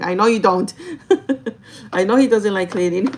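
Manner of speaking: loud burst breaking into real laughter; amused, laughing. Real, not acted. Girlfriend agreeing he doesn't like cleaning, amused and laughing.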